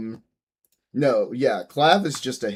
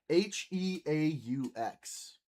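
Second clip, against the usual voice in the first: slow spelling out; 3 semitones higher, 7 dB quieter, slower than usual. Shown as a demonstration. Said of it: "make it a little French".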